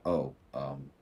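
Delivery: hurt Virgo quietly backing down; sheepish apology. Retold or imitated. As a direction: shrink away after being told off. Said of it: "they're thinking".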